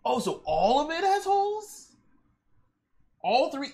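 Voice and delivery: high astonished question; high surprised. Real, not acted. Streamer reacts to a viewer's answer, high and surprised. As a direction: pitch it way up in surprise.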